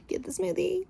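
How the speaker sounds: high-pitched exasperated squeak; exasperated plea. Real, not acted. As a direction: beg through a laugh. Exasperated at the man.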